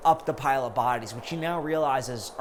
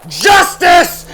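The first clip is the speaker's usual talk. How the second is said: high, very loud yelled verdict; screaming; 17 dB louder than usual. A narrator's reading. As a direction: roar it as he strikes. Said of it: "But then Batman screams".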